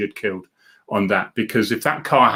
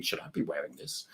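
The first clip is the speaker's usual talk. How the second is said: whispered thought; 8 semitones lower, 17 dB quieter than usual. Retold or imitated. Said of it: "I remember it thinking".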